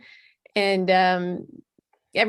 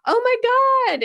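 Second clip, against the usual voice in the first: high, loud startled oh my God; mock shock at seeing her; hammed-up surprise; shocked gasp; 12 semitones higher, 5 dB louder, much faster than usual. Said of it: "he goes".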